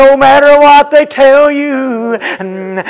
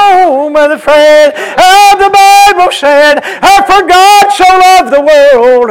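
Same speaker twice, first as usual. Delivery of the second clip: higher, ringing chant of the Bible verse; whooped preaching; 7 semitones higher, 6 dB louder than usual. Acted. Preacher chanting John 3:16 to the brethren, whooped. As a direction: sing out the scripture like a whooping preacher.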